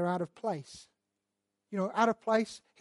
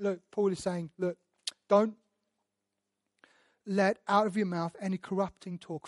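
normal talking voice

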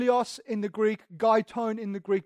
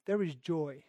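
lower, softer, hushed; soft solemn echo; 5 semitones lower, 7 dB quieter than usual. Acted. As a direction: savor the words quietly. Repeating verse softly.

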